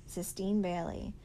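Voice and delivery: ordinary delivery, nothing marked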